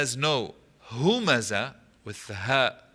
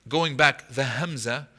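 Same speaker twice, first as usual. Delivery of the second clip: usual speaking voice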